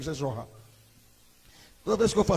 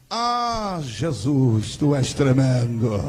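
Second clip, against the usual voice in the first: fervent prayer; 3 semitones lower, 7 dB louder, much slower than usual. Retold or imitated. Cousin praying on the phone.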